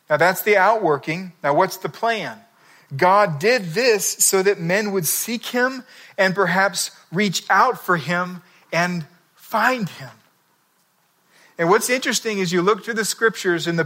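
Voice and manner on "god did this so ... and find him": slow, measured Bible reading; scripture reading. Acted. Preacher reading Acts to the congregation, slow and measured. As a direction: read it out slowly like a solemn verse.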